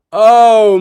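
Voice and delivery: a sudden loud oh at the screen; surprised exclamation. Real, not acted. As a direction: blurt it out, caught off guard. Reacting to a play on TV.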